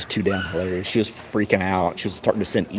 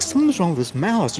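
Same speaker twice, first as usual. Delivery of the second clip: puzzled coworker; 9 semitones higher than usual. Retold or imitated. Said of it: "she's like".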